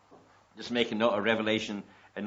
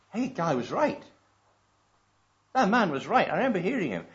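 realization; 6 semitones higher, 5 dB louder than usual. Retold or imitated. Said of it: "wake up one morning and say".

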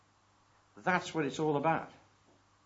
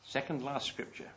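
ordinary talk, nothing marked

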